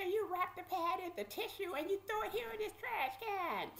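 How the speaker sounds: high-pitched instructing voice; imitated bossy sheriff. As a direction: lay down rules like a mean guard.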